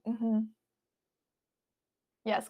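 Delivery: normal talking voice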